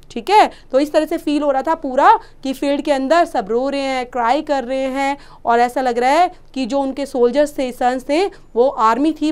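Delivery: ordinary talk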